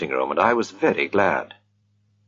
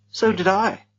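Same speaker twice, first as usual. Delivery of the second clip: trembling; 12 semitones higher than usual. Acted. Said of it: "in a trembling voice".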